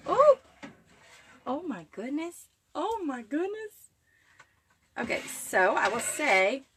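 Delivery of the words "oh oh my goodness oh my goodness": high, drawn-out oh my goodness, genuinely floored; real delighted surprise, pitch leaping up; amazed gasp. Real, not acted. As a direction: gasp at the bread coming out perfect. Seeing the cloud bread rise.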